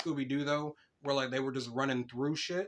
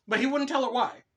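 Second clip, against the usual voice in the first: much higher and louder, amused; incredulous; 10 semitones higher, 8 dB louder than usual. Real, not acted. Laughing indignation.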